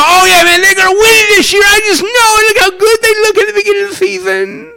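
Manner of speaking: high, loud, fast excited cheering; hopeful fan. Retold or imitated. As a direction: gush with season-opening hype. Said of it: "I go".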